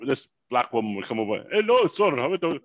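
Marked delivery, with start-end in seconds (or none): 1.5-2.6 higher, louder imitated Jamaican accent. Beach waitress greeting a tourist, imitated Jamaican accent. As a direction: call out in a put-on Jamaican accent. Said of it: "in this Jamaican accent".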